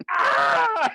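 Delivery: very high, loud shriek of "Ah!"; an old lady screaming in shock. Retold or imitated. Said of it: "And she goes".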